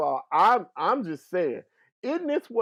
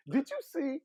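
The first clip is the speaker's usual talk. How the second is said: ordinary delivery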